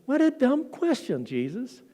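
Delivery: high mocking jab that drops away; sarcastic disciples. Disciples scoffing at Jesus, a high sarcastic jab. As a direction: needle him with a sneer. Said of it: "It's almost sarcastic".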